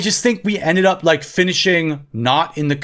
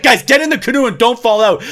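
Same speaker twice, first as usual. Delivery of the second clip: mildly raised, urgent order; retold yelling; 5 semitones higher, 3 dB louder than usual. Telling family to get back in the canoe, mild yell. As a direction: lightly act out calling orders over water. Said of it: "I was yelling at them so much".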